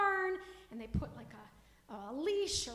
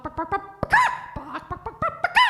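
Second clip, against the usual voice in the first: loud, rapid barking noises; 4 semitones higher, 14 dB louder than usual. Acted. Storyteller barking like the chaotic house pets. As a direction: bark it out like a frantic dog.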